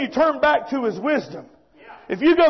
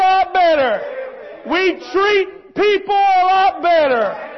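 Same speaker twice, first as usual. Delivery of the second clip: raised, insistent preacher's voice; impassioned preaching; 3 semitones higher than usual. Real, not acted. Preacher voices God teaching His son, impassioned preaching. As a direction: drive each phrase home to the congregation.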